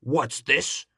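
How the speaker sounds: loud, great big bear growl; booming papa bear; growling father bear. A narrator's reading. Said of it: "growled the father bear in his great big voice".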